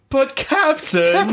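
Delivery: high, drawn-out whiny plea of "Captain"; Tim Curry impression. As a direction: stretch out a pouty, rising "But Captain!". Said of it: "just Tim Curry going".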